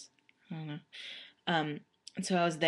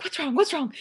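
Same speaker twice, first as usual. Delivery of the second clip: high, urgent imitation of mom; alarmed mom; 11 semitones higher, 6 dB louder than usual. Mom answering her sobbing daughter's call, imitated high alarm. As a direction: fret it in a high voice.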